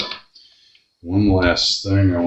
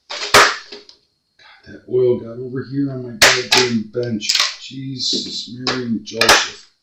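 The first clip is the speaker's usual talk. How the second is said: real exasperation, voice pitched up; exasperated cursing; 6 semitones higher than usual. Real, not acted. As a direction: curse the mess in a higher voice. Finds oil spilled on bench.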